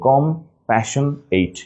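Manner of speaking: slow "compassionate" as com, passion, ate; broken into syllables. Shown as a demonstration. Teacher showing spelling, "compassionate" slowly in pieces. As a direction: break "compassionate" apart, full "ate" ending. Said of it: "just একটু ভেঙে নিব".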